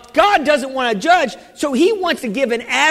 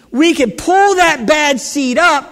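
loud, slow shout, pull punched; slow preaching shout on pull; 4 semitones higher, 5 dB louder than usual. Acted. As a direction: proclaim it to the back row. Preacher climax, shout heard.

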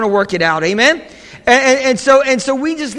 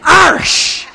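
emphatic Irish; 7 semitones higher, 5 dB louder than usual. Retold or imitated. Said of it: "I'm Irish".

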